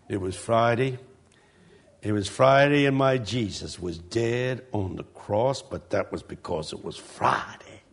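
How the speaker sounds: low, hushed preaching, slowing and sinking; soft sermon opening. Retold or imitated. Pastor preaches of Jesus dead on the cross, soft and low. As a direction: imitate a preacher starting off very soft. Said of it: "He always starts off soft".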